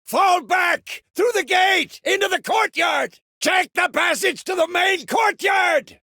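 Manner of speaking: high, urgent battle yell; shouted retreat orders. Acted. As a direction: bellow commands to the troops.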